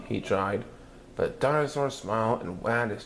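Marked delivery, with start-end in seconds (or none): none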